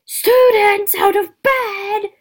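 slow, high, drawn-out bellow. Acted. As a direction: holler it out to wake everyone. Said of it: "Peeves bellowed".

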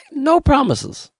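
high-pitched mock voice; mock noncommittal official. Officials answering taxpayers about their benefits, high mock voice. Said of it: "people who are saying".

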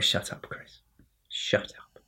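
hushed, voiceless whisper; whispered telling-off. Real, not acted. Host telling Chris to shut up, whispered. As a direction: whisper it as an aside to silence someone.

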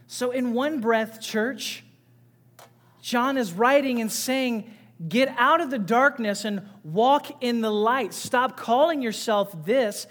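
loud, pressing sermon voice; fiery preaching. Acted. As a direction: preach it hard to the congregation. Preaching to the church.

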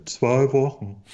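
tief und abgehackt wie ein Roboter-Actionheld; Schwarzenegger-Imitat. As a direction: wie Arnold im Film sprechen. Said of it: "Aus welchem Film?". (Arnold-Schwarzenegger-Stimme)

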